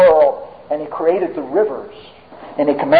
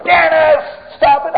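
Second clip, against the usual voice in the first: imitated angry yell; 12 semitones higher than usual. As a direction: snap at a misbehaving child across the house. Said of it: "She'd yell even".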